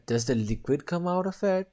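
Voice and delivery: ordinary talk, nothing marked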